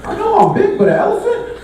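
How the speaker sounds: high, indignant mock outrage; mock offended. Comic to audience, high mock indignation over the elephant joke. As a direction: play hurt with a high squeak.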